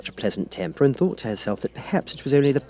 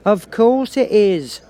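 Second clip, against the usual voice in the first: high, loud, slow agreement; Duchess, much louder and higher; eager Duchess; 12 semitones higher, 6 dB louder, much slower than usual. A narrator's reading. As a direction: agree with everything. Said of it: "said the Duchess".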